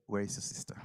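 hushed, breathy whisper; whispered question. Acted. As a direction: ask it in a whisper. Jesus asks softly.

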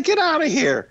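high, squeaky get-out-of-here; mock disbelief. Telling partner his idea is absurd, high mock disbelief.

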